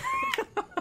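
squealing high laugh. Real, not acted. Laughing at poop water.

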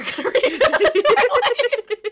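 breaking into loud, high laughter mid-sentence; real laughter. Real, not acted. Host introducing the Twilight segment, helplessly laughing. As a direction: crack up while trying to announce it.

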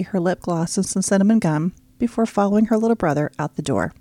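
plain reading voice

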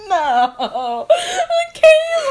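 shrieking name through laughter; laughing shriek. Real, not acted. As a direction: squeal her name, laughing.

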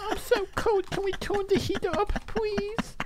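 put-on very high, soft baby-talk whine; cutesy pleading. Acts out cutesy couple talk, whining.

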